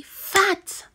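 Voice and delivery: breathy whisper of fat. Shown as a demonstration. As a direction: phonics whisper. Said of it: "teacher whispers word".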